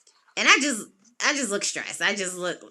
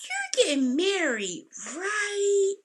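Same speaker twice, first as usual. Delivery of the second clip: high, slow, curious question; receptionist asking; 10 semitones higher, 4 dB quieter, much slower than usual. Retold or imitated. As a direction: ask it lightly, curious. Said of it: "And she was like".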